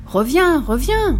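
high, loud call after a runaway; crying out. A narrator's reading. Old lady running after the gingerbread man, crying out. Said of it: "en lui criant".

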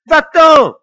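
very high, very loud shout; furious cry. A narrator's reading. Lily driving the devil away, furious shout. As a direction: scream him away in fury. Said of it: "s'écria-t-elle furieuse".

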